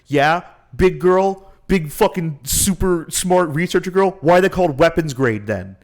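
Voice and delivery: lightly voiced scornful villain; sneering villain. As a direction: taunt her like a smug bully. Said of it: "and he goes".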